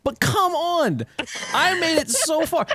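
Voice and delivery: high, drawn-out plea; protesting. Real, not acted. Sleepy streamer defending himself to friends, protesting. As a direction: whine it slowly, pitched well up. Pleading his case to teasing friends.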